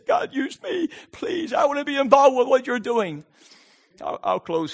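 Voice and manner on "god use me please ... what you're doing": high, urgent plea to God; pleading. Acted. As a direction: beg earnestly to be used. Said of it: "beg and plead and say".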